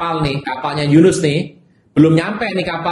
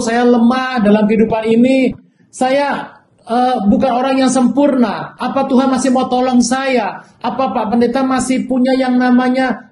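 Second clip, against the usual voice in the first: humble churchgoer; 7 semitones higher than usual. Retold or imitated. Churchgoer confessing weakness to the pastor, higher and pleading. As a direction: imitate a weak believer asking for help.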